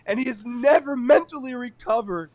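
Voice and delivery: high, loud mock outrage, near a shout; a shocked anchor hamming up the horror; mock appalled TV host. Hosts denouncing the podcast's sketch, in high, loud mock outrage. Mocking Today Show hosts on air.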